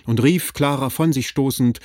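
plain reading voice

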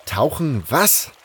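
rising, startled question; incredulous. Acted. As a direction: question it in disbelief, pitch climbing. Baffled by order to dive.

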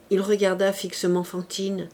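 ordinary delivery

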